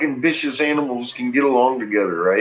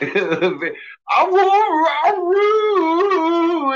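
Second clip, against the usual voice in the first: very high, whining, laughing dog voice; a squeaky, pleading doggy whine; whiny dog voice; much slower than usual. Pretend. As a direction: whine it like a begging, excited dog. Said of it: "it erupted into a".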